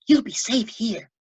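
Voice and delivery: put-on high childlike voice, lively; a boy reassuring his hidden pet; Lois the boy, eager. A narrator's reading. Boy hiding his pet tadpole, high and eager. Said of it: "whispered Lois".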